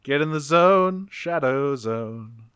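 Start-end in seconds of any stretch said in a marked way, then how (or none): 1.1-2.4 slow, deep trailer voice echoing the title; dramatic announcer. Pretend. Co-host echoes the episode title in a slow trailer voice. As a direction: intone it like a movie trailer.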